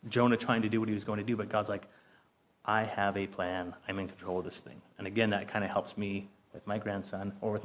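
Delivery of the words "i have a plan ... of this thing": calm God voice. Pretend. Voicing God taking control.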